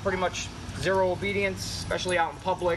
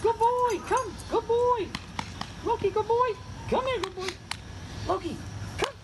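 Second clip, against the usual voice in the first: squeaky praising a dog. Real, not acted. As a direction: gush at the puppy in falsetto.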